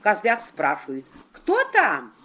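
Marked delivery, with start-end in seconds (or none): 1.3-2.1 put-on small kids calling out high; little goats asking, high and wary; high child voice. Kids call through the door.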